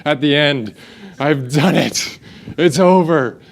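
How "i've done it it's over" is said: weary hero at quest's end, imitated; Frodo. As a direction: act out exhausted relief at the end. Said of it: "It felt like Frodo".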